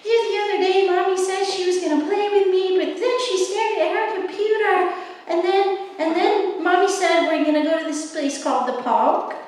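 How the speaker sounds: put-on a light baby-dog voice. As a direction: whine like a little kid in therapy. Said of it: "a baby voice".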